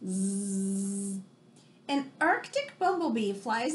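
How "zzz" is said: soft pretend snoring; a sleepy fake snore for the class; playful little snore sound; mock snore. Mock snore after page joke.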